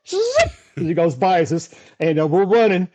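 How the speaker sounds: ordinary talk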